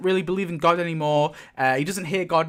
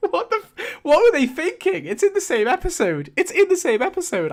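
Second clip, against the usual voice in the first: high, exasperated disbelief; can't believe what they did; 10 semitones higher than usual. Real, not acted. Exasperated rant, high pitch.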